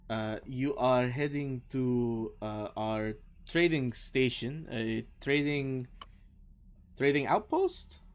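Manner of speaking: put-on slow, word-searching French-accented speech; not flawless Common. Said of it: "perhaps not flawless".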